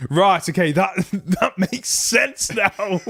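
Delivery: slow, laughing through the words; laughing realization. Retold or imitated. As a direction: chuckle as it dawns on you.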